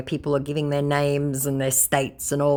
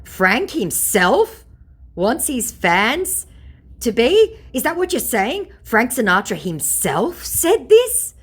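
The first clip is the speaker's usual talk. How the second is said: slow, high, drawn-out incredulous questioning; high squeak on "to be?"; sarcastic disbelief; 8 semitones higher, 4 dB louder, slower than usual. Retold or imitated. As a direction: spell it out mockingly, word by word.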